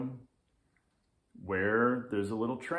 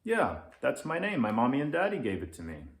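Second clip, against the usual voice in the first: put-on higher, fast chirpy child; little kid; 5 semitones higher, 3 dB louder, faster than usual. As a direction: chirp it out proudly. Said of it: "says little Leo".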